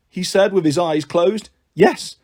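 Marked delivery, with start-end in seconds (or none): none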